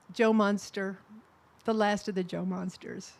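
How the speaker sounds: ordinary talk, nothing marked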